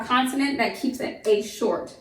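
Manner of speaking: usual speaking voice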